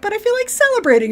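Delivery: high, fast, gleeful sing-song; playful Q. Retold or imitated. Host voicing Q answering Picard, high and fast. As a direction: tease like a delighted trickster. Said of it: "Q's like".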